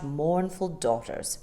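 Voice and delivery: plain reading voice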